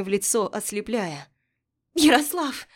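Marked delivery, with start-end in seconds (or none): 1.9-2.7 high, loud call over wind. Acted. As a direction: call his name into the storm. Calling out in the storm.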